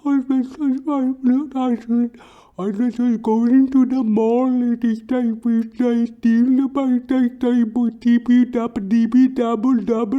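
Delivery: very high, slurred muttering voice; high mumbling narcoleptic coworker; mumbling Sleepy. Retold or imitated. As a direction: babble on in a squeaky high mumble. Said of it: "he just mutters on and on".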